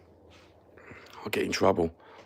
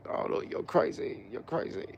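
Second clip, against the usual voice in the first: mocking critics; 7 dB quieter than usual.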